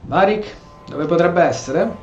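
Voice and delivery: ordinary talk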